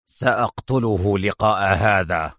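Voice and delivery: low, slow threat; resentful anger. A narrator's reading. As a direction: draw it out with contained fury. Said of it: "قال نيكولاس في حنق".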